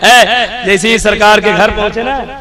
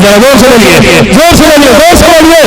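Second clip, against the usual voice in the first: rousing the crowd; 2 semitones higher than usual. Real, not acted. Preacher calling listeners to answer, building exhortation. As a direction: urge the audience on, rising each time.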